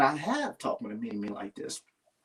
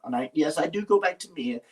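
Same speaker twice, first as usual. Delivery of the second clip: usual speaking voice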